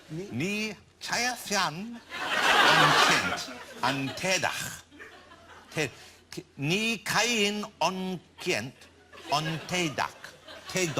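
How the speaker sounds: very slow, word-by-word attempt at Irish; flying blind through unfamiliar Irish words; halting foreign reading. Real, not acted. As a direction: sound out each strange syllable separately, with gaps. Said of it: "it's the bit I'm finding difficult".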